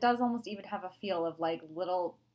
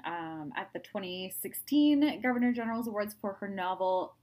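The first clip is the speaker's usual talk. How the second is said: normal talking voice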